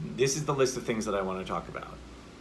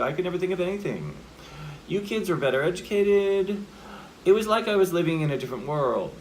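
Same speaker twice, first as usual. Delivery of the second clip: higher voice, endings held up instead of settling; not full stopping; 6 semitones higher, 4 dB louder than usual. Shown as a demonstration. Coach imitating what actors like to do, lifted unfinished endings. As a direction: keep ANYTHING and EDUCATED lifted and hanging. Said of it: "if we're not full stopping".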